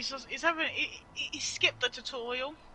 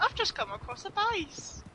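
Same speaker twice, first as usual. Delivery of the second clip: imitating brother; 10 semitones higher than usual. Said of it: "he's like".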